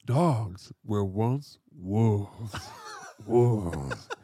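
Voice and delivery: very slow, drawn-out impression of a science presenter; Neil deGrasse Tyson. Shown as a demonstration. Co-host showing off his requested science presenter impression, slow and drawn-out. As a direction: draw each word out slowly, breaking into a laugh. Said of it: "I love your Neil deGrasse Tyson".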